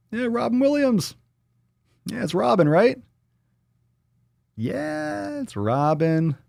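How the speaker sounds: high-pitched goofy squeal; silly high voice. Pretend. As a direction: play it squeaky high, just fooling.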